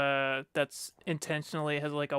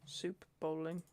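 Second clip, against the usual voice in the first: muttering; 2 semitones higher, 14 dB quieter, slower than usual. Real, not acted. Typing search, quiet.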